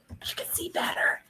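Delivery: hushed aside; whisper. Acted. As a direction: whisper it close to the kids. Teacher whispers aside to kids.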